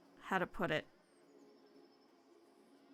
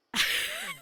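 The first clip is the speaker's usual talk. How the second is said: high squeaky laugh. Real, not acted. Bursts out laughing.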